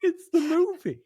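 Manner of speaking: excited fan talk. Real, not acted. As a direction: gush about the ride. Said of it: "You're absolutely right".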